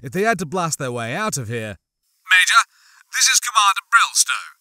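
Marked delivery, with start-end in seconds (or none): 2.3-4.6 loud, higher radio voice; commander over comm. A narrator's reading. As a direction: announce yourself firmly through a comm speaker. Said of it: "a new voice put in".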